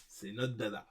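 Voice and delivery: normal talking voice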